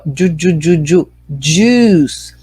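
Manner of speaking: ju ju ju repeated, then juice with a long u; juice syllable drill. Shown as a demonstration. Teacher drills the long vowel in juice. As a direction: break juice into ju before saying it whole. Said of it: "quando tem esses dois pontinhos aqui, significa que o som é longo".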